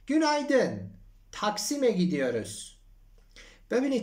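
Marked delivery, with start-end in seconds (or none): 0.1-2.5 acted dialogue. Acts Mariana in the taxi.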